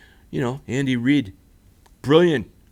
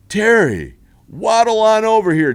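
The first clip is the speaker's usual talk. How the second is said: loud, higher hammed-up host call; mock Terry call; 9 semitones higher, 7 dB louder than usual. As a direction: bellow it like a goofy TV host. Mocking Terry Bradshaw's call again.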